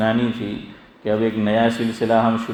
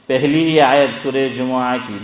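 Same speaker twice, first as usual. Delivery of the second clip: normal talking voice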